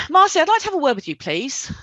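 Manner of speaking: high, loud and confrontational opening; cross confrontational. Acted. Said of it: "your tone of voice was a little bit cross-confrontational".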